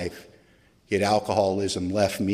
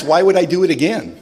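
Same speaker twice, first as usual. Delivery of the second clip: usual speaking voice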